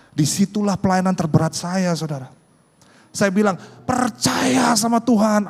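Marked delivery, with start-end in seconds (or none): 3.9-5.5 high, loud, drawn-out exhortation; slow, stretched pulpit call; mock preaching. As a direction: belt it out like a preacher putting on conviction. Mimics himself preaching while doubting.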